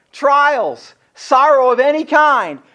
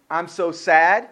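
low, dropped mock-sad voice; put-on glum voice, sinking low; 7 semitones lower, 4 dB quieter, slower than usual. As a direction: drop the pitch and play at being sad. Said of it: "The funny thing about".

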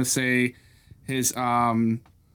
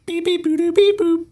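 high electronic beeps; beeping communicator; 5 dB louder than usual. Pretend. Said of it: "I'll go with".

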